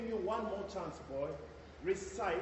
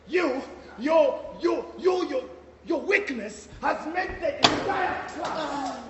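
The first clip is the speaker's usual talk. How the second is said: louder, higher, stammering, then slow; sputtering rage; 5 semitones higher, 9 dB louder, slower than usual. Acted. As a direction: choke on rage before the accusation. Headmistress sputters at teacher.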